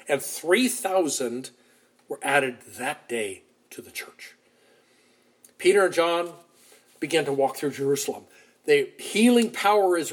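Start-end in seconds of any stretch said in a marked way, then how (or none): 2.1-4.3 soft whispered close; hushed whisper. Real, not acted. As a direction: whisper it with wonder. Preacher's hushed aside.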